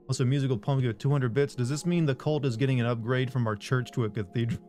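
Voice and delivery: normal talking voice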